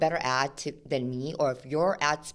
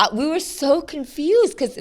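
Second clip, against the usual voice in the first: excited; 12 semitones higher, 10 dB louder than usual. Real, not acted. Excited retelling of billboards.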